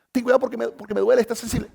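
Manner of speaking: anxious wife. Retold or imitated. Wife warning it hurts.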